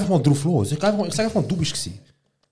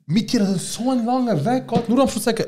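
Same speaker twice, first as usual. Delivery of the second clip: outraged; 10 semitones higher than usual. Real, not acted. Indignant rant about janitor.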